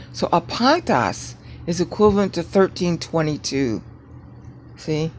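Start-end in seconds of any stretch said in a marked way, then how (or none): none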